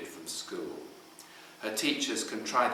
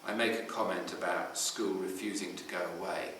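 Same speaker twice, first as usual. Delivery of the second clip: plain reading voice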